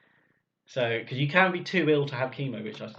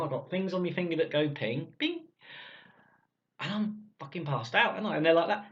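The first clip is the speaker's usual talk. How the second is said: ordinary talk, nothing marked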